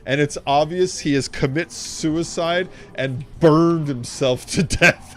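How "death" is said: usual speaking voice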